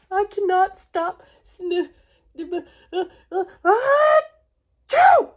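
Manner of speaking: stuttering uh, uh, then a loud high sneeze; building to a sneeze. Acted. Gerald tells Piggy he can't stop, then sneezes loudly. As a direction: let the sneeze build and burst.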